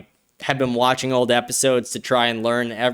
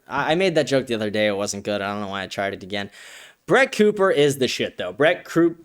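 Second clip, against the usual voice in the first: ordinary talk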